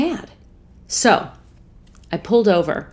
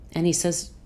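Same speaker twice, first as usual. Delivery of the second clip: ordinary talk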